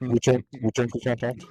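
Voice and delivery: loud radio call, repeated for effect. Retold or imitated. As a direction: hammer it again like a tanker on comms. Tanker boom operator radio call.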